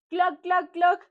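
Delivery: loud, high hen clucks; three short, punched clucks; imitación de gallina. Shown as a demonstration. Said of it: "¿Cómo hace la gallina?". (cloqueo agudo y fuerte)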